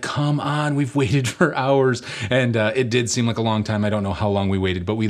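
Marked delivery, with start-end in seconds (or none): 0.0-2.0 higher-pitched, drawn-out mock pleading; retold family exasperation, stretched out; exasperated urging. Family urges a balking child after a long wait, imitated exasperation.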